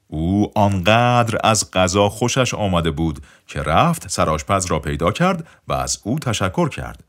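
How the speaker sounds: plain reading voice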